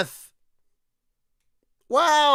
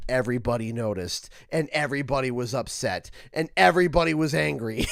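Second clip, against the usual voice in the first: lower, fast deadpan building to shout; 11 semitones lower, 4 dB quieter, faster than usual. Real, not acted. Telling fans how players reacted, fast deadpan. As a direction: rattle off the consequences, deadpan. Rant punchline, low and fast.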